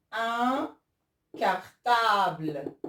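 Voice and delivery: "un cartable" very slowly, syllable by syllable; slow dictation. Shown as a demonstration. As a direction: stretch out "cartable", loud and clear. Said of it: "écoutez bien".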